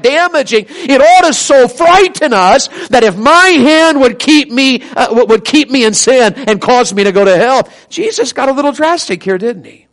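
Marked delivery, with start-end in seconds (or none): 0.7-7.7 loud, driving sermon delivery; preaching. Real, not acted. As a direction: hammer the warning home to the congregation. Preaches at full force.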